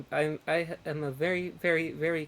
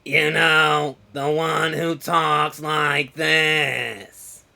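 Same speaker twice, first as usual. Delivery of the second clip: slow, loud cartoon character voice; very slow Monsters, Inc. impression; slug lady; 4 semitones lower, 8 dB louder, much slower than usual. Shown as a demonstration. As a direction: drag every word out in a loud drone. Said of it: "I can do that voice".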